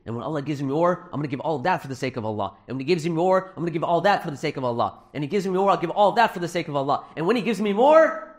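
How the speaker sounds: quick rattled-off promise, climbing in pitch and volume; escalating repetition. Retold or imitated. Son keeps repeating, building to punchline.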